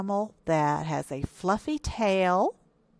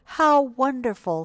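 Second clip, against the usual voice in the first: raised, louder motherly praise; delighted mother; 6 semitones higher, 5 dB louder than usual. A narrator's reading. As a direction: praise the little one with delight. Mother delighted by hippo's news.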